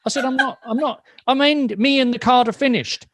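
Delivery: high, half-laughing protest that she's not upset; mock upset. Retelling the call to the card company, mock-indignant insisting. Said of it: "You sound upset".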